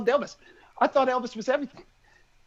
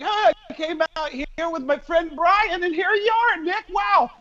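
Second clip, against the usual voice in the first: higher, thrilled greeting; 8 semitones higher than usual. Retold or imitated. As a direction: gush like you're starstruck. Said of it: "[name]'s like".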